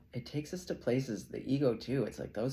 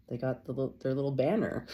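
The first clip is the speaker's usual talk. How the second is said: ordinary delivery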